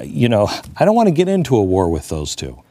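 ordinary talk

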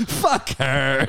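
mock contemptuous dismissal; disrespectful Bill Maher. Imitating Maher dismissing the Queen on TV, disrespectful. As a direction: toss it off with open scorn. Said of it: "he's just so disrespectful".

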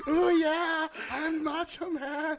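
high, slow hammed-up wrestler voice; a terrible Macho Man; bad Macho Man impression.

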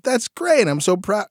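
playful put-on dad praise; dad mode. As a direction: cheer her on like a dad. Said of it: "just going into, like, dad mode".

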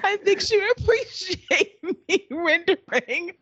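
high voice cracking with laughter; quoting Booker through helpless laughter; laughing through a quote. Retold or imitated. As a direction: try to quote Booker while losing it laughing. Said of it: "direct quote from Booker".

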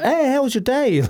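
very high, sing-song mock cheerfulness; breezy small talk, sent up; a trainer chatting away mid-workout, hammed up; chirpy trainer. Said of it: "They're like".

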